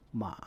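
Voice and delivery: ma dipping low then rising; ma in Mandarin third tone, dipping. Shown as a demonstration. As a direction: let ma sink, then come back up. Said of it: "The third tone is a falling, then rising tone".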